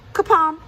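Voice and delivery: sudden high, loud bang word; storyteller's bang word; kapom sound effect. A narrator's reading. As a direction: pop it out like a thump. Sudden fall in folktale.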